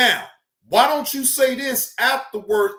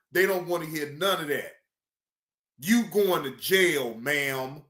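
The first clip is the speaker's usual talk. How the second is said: ordinary talk, nothing marked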